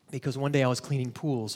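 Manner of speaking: ordinary talk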